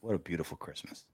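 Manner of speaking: ordinary talk, nothing marked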